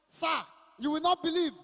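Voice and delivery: loud, emphatic call to the audience; loud appeal. Real, not acted. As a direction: grab the room's attention. Comic addresses audience loudly.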